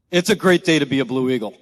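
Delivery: loud, proud unit motto; rallying shout. Real, not acted. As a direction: call it out to the whole formation. Motto called out to assembled unit.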